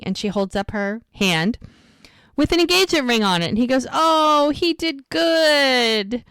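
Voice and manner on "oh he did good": high, drawn-out delighted approval; mock-impressed, stretched-out praise; impressed admiration. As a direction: gush it slowly, pitched up, imitating him. Doc admires the engagement ring, retold.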